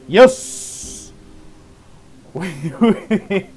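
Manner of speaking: sudden triumphant yell, high and loud; excited shout. Real, not acted. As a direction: yell it out in relief. Clutch escape in game.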